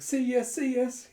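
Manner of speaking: put-on high, loud kid pleading; urgent, high-pitched coaxing; eager begging. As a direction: plead excitedly like a small child. Child begging parent to join.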